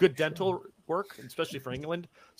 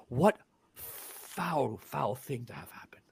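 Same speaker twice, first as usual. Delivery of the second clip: whispered, low and soft; horrified whisper; 4 semitones lower, 8 dB quieter, slower than usual. Acted. Peddler muttering as he walks off, whispered. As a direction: breathe it out in horror.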